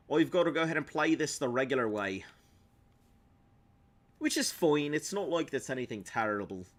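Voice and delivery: high, lilting stage Irish; Irish accent. Acted. Streamer explaining the problem with mods, put-on Irish accent. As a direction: lay the Irish accent on thick. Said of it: "Not all Irish people talk like that".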